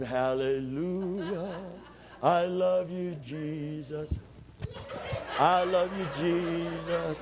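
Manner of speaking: lightly voiced piety; mock pious worshipper. Mocking pious parent in church.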